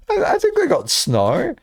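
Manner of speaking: slow, high words after laughter; still giggling. Real, not acted. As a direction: settle down from laughing.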